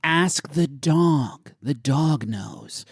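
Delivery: very slow, drawn-out words; mock ominous. Co-host refers the question to the dog, mock ominous. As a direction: stretch it out like a cryptic warning.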